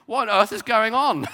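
loud, high incredulous question; retold astonishment. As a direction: blurt it out as a baffled colleague. Third colleague's astonished question, acted out.

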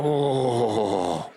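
long, low drawn-out woah; mock group groan of dismay; crowd's uneasy whoa. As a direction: let a low whoa sink away. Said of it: "Where everyone's like".

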